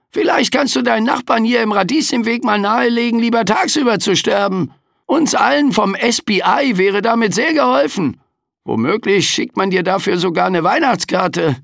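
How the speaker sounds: high, sarcastic, weary Reaper; overworked Death complaining; grumpy Grim Reaper. A narrator's reading. Death keeps talking. (mürrischer Sensenmann)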